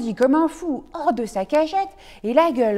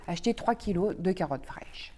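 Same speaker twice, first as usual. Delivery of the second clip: whispered aside; 4 semitones lower, 7 dB quieter than usual. A narrator's reading.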